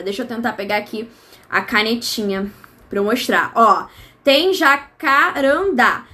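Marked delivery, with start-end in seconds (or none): 4.5-6.1 JACARANDÁ slowly broken into syllables; syllabe par syllabe. Shown as a demonstration. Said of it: "A gente conta sempre".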